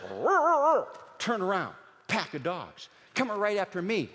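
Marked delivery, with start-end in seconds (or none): 0.0-0.9 mock dog roar. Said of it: "I heard this".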